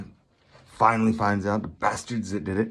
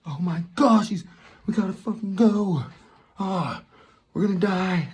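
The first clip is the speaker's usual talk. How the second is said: panicked; 10 semitones higher than usual. Retold or imitated. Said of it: "And Josh Hartnett's like".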